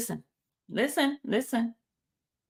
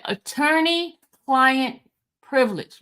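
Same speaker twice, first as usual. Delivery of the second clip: "Attorney-client privilege" drawn out, pitched up then falling; slow reading. Shown as a demonstration. Host repeating the key phrase for listeners, slow and emphatic. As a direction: stretch "Attorney-client privilege" out so they get it. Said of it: "I said I was gonna read it slow".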